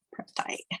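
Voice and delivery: soft breathy whisper of pretty. Real, not acted. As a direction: whisper the word to yourself. Whispered echo while crafting.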